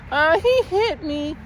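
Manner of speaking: teacher imitating a little girl telling on a boy, high and whiny; whiny tattling girl. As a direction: drag it out like a tattling child.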